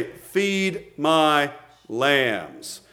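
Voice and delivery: slow, low, soft and tender; gentle command. Acted. Jesus answering Peter in a slow, tender low voice. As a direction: lay each word down gently like a shepherd. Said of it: "what does he say".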